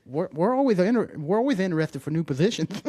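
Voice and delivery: movie line quote. Retold or imitated. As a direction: pitch it like a cheesy host.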